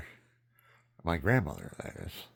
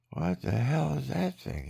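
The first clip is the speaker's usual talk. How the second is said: higher, startled question; shocked child; 9 semitones higher than usual. Retold or imitated. Boy staring at his naked father, startled and higher. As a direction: ask it like a bewildered kid.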